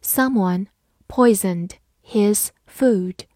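slow, word-by-word model reading. Shown as a demonstration. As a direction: read it slowly, pausing between words. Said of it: "好，慢慢来读". (慢慢朗读，逐词停顿)

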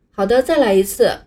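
ordinary talk, nothing marked

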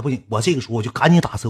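usual speaking voice

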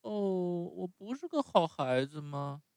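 高出许多的稚嫩童声，带着依恋; 像小木偶一样怯生生地撒娇; 木偶孩子的声音. Acted. Narrator voices Pinocchio. (用高高的童声扮演匹诺乔)

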